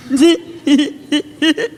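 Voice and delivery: stuttering giggle building into hysterics; hysterical laugh. Acted. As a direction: start in little bursts, then let the laugh run away. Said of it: "I got a little hysterical".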